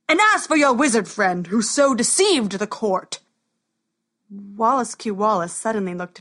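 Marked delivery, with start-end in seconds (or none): 0.1-3.2 loud, stern royal voice; booming warrior king. Acted. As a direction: address the wizard sternly. King turns to wizard.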